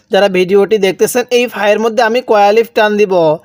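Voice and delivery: normal talking voice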